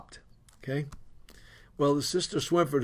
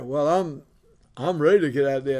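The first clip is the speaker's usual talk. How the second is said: ordinary delivery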